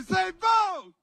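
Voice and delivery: high, loud shouted cue; rallying shout. Shown as a demonstration. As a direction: shout the word to lead the crowd. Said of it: "say it so loud".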